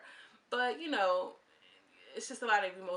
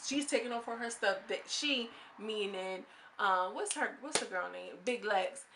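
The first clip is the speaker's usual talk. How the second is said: usual speaking voice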